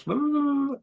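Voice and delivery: squeaky sped-up chipmunk voice; high, fast cartoon chipmunk. Shown as a demonstration. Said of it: "real fast".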